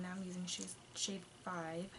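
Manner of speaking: ordinary talk, nothing marked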